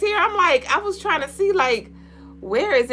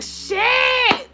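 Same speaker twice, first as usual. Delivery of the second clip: high startled curse; 14 semitones higher than usual. Retold or imitated. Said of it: "I'm like".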